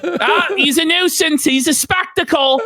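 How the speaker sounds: hammed-up Toronto dude accent with laughs; mock Toronto accent. Mocking how Toronto guys talk, in a hammed-up Toronto accent. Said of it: "like that Toronto accent".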